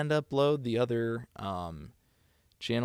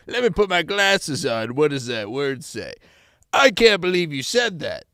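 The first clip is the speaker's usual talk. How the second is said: high, loud scandalized voice; mock shock; 5 semitones higher, 10 dB louder than usual. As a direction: act outraged at the chat.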